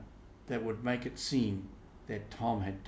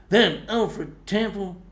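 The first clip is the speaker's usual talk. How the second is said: put-on slow, loud, higher seething boy; jealous Tom; 10 semitones higher, 10 dB louder, slower than usual. Tom brooding over Alfred Temple, slow jealous anger. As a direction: spit the rival's name out slowly.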